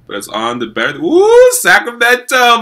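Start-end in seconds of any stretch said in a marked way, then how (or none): 1.0-1.5 high, loud excited whoop. Real, not acted. Celebrating trade to Sacramento. 1.5-2.6 Sacramento drawn out high, loud and slow; excited shout. Real, not acted. Celebrating trade to Sacramento.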